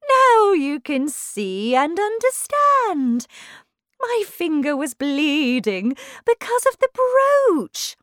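high, slow, swooping Queen; Queen explaining emphatically. Acted. Queen explains her bleeding finger to Alice, high and emphatic.

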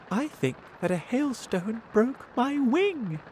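high, plaintive little voice; hurt ladybird. A narrator's reading. Ladybird has a broken wing, plaintive. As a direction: complain pitifully, pitched well up.

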